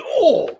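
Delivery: high, loud mock retch; mimicked disgusted doctor's "oh"; imitated queasy groan. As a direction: cry out as if about to throw up. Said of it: "he was, like, gonna throw up".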